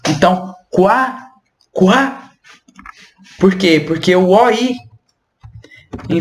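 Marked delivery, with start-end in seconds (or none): 0.7-2.3 quá said as "kwa", no final T; quá without T. Shown as a demonstration. Teacher showing the French oi sound as kwa. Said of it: "Sem o T".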